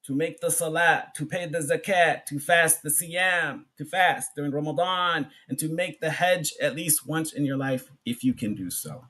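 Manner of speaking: ordinary talk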